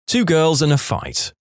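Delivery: ordinary talk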